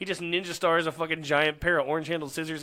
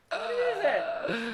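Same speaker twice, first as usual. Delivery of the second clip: very high, slow, drawn-out squeak; high squeaky voice; much slower than usual. Retold or imitated. Friend mimicking a kid in a video, high and slow. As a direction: stretch it out high like a little kid.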